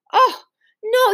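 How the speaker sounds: high, loud cry of dismay. A narrator's reading. Girl dismayed at being sent into the rain. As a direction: protest in sudden alarm.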